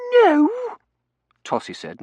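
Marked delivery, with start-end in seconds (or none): 0.1-0.5 very high, loud little shriek of "No?"; a scream in miniature; screamlet. A narrator's reading. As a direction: squeal it in shocked disbelief. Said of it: "making it into a screamlet".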